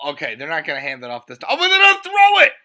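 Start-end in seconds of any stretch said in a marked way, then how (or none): 1.5-2.5 much higher, loud announcer-style shouting; hype shout. Pretend. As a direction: yell it like a stadium call. Hyping a surprise touchdown.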